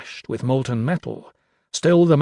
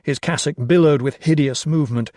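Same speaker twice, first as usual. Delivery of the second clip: ordinary delivery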